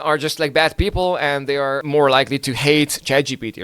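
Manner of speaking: usual speaking voice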